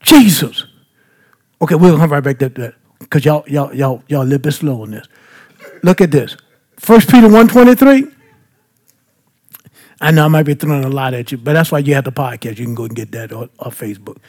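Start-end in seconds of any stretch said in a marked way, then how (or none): 1.6-5.1 quick, quieter side remark; low aside. Real, not acted. 10.0-14.2 quick, low, fading side note; hushed aside. Real, not acted. Podcast aside, trailing away.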